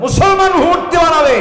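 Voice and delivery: very loud, high-pitched outcry; outraged shout. Real, not acted. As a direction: explode in protest before the crowd. Outraged preacher shouting objection. (তীব্র ক্ষোভে চিৎকার)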